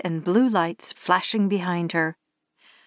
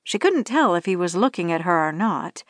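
plain reading voice

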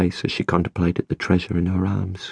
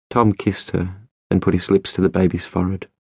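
plain reading voice

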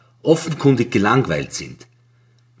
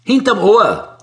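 curt; 10 semitones higher, 6 dB louder, slower than usual. A narrator's reading. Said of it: "sagt er knapp".

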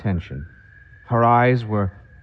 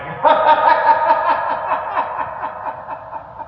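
laughing. Acted. Singer laughs after song.

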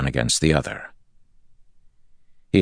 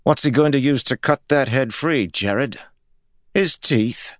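high, mocking question; insolent; 11 semitones higher than usual. A narrator's reading. Insubordinate guard mocking his lord, insolent. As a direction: needle him with no respect. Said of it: "Ares' voice bore little respect".

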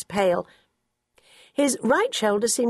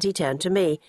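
ordinary delivery

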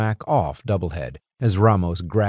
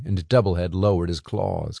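usual speaking voice

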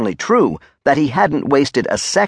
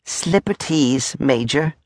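high, drawn-out coy answer; 4 semitones higher, slower than usual. A narrator's reading. Soldier showing his slippers to the Major, coy and drawn out. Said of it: "Clot suddenly became coy".

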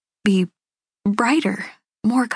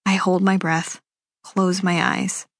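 plain reading voice

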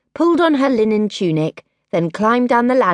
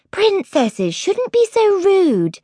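higher, slow and proper; prim older sister; 14 semitones higher, slower than usual. A narrator's reading. Oldest sister scolding Rudica, prim and slow. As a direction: tut at her little sister. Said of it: "said her oldest sister".

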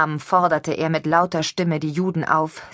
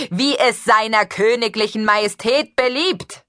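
proclaiming loudly; 5 semitones higher, 5 dB louder than usual. Acted. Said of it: "rief der Dominikaner".

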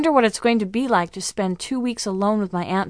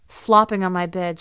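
usual speaking voice

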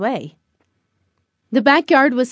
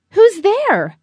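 high, raised call into the unknown; 8 semitones higher, 4 dB louder than usual. A narrator's reading. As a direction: call out to an unseen intruder. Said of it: "Barney called out".

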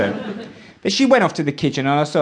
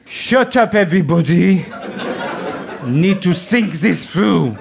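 mock genius chef; 4 semitones higher than usual. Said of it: "He's gone and got a bit of fish".

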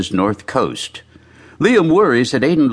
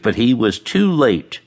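usual speaking voice

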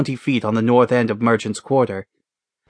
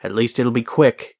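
usual speaking voice

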